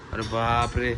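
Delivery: low, soft hushed "अरे बापरे"; mock shock. Looking at her leg, hushed low dismay. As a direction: drop low and quiet in dismay. Hurt child shown to viewers.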